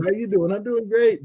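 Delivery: high-pitched, cheery quick exchange; breezy small talk. Shown as a demonstration. Americans swapping pleasantries before moving on, breezy throwaway. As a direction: rattle off a greeting and move on. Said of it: "It's supposed to be".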